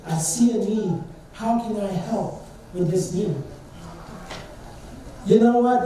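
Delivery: ordinary talk, nothing marked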